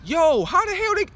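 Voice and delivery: imitated disbelief, pitched high and loud; mock bafflement. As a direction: act stunned, voice jumping up. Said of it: "You like".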